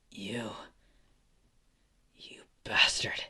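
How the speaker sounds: halting, breathy whisper; weak defiance. Acted. Beaten Izuku cursing Endeavor after coughing, weak halting whisper. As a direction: squeeze the words out between long pauses.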